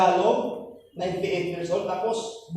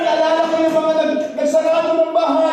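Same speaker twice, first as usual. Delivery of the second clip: high, loud sung-out preaching; fervent preaching; 10 semitones higher, 6 dB louder than usual. Acted. Pastor exhorts the church members, fervent preaching. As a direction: preach with rising fervour.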